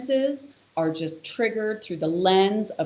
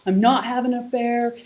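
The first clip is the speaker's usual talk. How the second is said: higher, louder defensive protest; partner's defensive denial; 6 semitones higher, 4 dB louder than usual. Retold or imitated. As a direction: push back at the accusation, voice up. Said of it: "and she's like".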